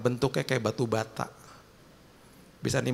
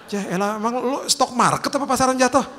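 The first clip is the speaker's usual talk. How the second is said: exasperated; 9 semitones higher, 5 dB louder than usual. Retold or imitated. Mocking friend's excuse.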